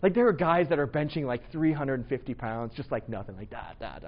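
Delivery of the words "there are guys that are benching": ordinary talk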